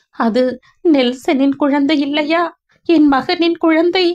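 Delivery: put-on an old father who can't contain his eagerness; a father asks, breathless with hope, if the baby is his son's; eager excitement. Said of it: "அடக்க முடியாத ஆவலுடன் கேட்டார்".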